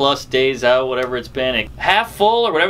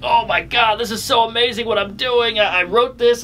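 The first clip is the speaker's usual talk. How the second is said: a mildly higher, excited rush at his own work; 5 semitones higher than usual. Retold or imitated. Comic recalls his first night back, mildly excited. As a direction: gush a little about your own jokes. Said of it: "be like".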